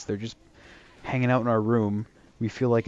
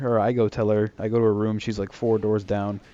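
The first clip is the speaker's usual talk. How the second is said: normal talking voice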